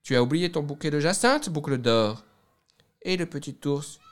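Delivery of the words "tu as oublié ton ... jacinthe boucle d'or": a hint of a higher, louder bear call; lightly voiced middle bear calling out; middle bear's voice. The middle bear reminds Goldilocks of her flowers, lightly voiced. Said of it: "de sa moyenne voix".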